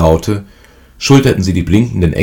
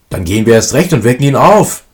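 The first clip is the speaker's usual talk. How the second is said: higher and louder, defiant; cocky defiance; 6 semitones higher, 4 dB louder than usual. A narrator's reading. Eldest son defying his mother, cocky and loud. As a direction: brush the warning off boldly. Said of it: "übermütig und trotzig wie einst".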